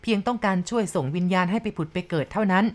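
plain reading voice

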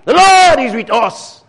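high, loud mock cheering; exultant crowd cry. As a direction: shout it like a rallied crowd, drawing out the last phrase. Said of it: "these guys would say".

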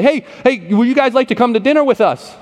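high, eager invite; friendly invitation. Retold or imitated. Church people calling out an invitation.

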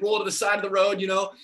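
ordinary delivery

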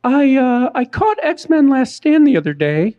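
high-pitched imitation of a woman; hammed-up woman's voice; put-on lady voice. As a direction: pitch it way up as a lady reading her question. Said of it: "volunteering to do a woman's voice".

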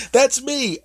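chipper high voice. Acted. Chipper reply.

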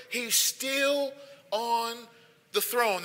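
"He's still on the throne." drawn out very slow and soft, stretching "still"; soft, slow restatement. Acted. Preacher restating the sermon theme, hushed and slow. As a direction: let it sink in, softly and slowly. Repeats point softly.

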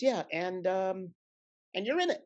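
high, building excitement as he breaks the news; excited reveal. Retold or imitated. Larry tells the actor in the commissary, excited and high. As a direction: pitch it up and land it on the last word. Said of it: "he goes".

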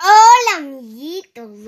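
big greeting. Real, not acted. Greeting little friends before a made-up story, big greeting.

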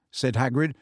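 ordinary delivery, nothing marked

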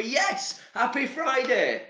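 high, slow, beaming greeting; mock cheery greeting. Vlogger showing how to greet a stranger, hammed-up cheery. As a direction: greet them over the top. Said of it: "Give 'em a".

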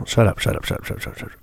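real urgent whisper to self, repeated; whispered self-talk. Real, not acted. Straining to recall the Fable actor, urgent whisper. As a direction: hiss it to yourself while thinking hard.

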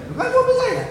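high, puzzled wife's voice; a wife baffled at the question. Retold or imitated. Wife answering her forgetful husband, high puzzled voice.

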